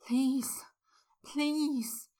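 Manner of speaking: breathy whispered please, please; desperate plea. A narrator's reading. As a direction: beg on a rising whisper. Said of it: "he cried out".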